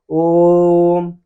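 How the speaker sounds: ओम on one long held note; drawn-out vowel. Shown as a demonstration. Teacher demonstrates prolated vowel for class, ओम held long. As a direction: stretch the ओ of ओम very long. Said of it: "वही जब उच्चारण करता है".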